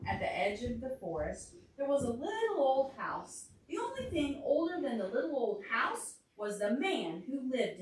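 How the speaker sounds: lively storybook reading; reading to a child. A narrator's reading. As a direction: read it aloud for a little listener. Reads picture book to child.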